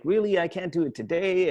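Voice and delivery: mom's high, loud startled "Really?"; surprised mom. Retold or imitated. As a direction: play the mom caught off guard, pitch jumping up. Mom reacting to request.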